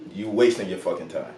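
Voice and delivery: usual speaking voice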